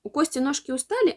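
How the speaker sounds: ordinary delivery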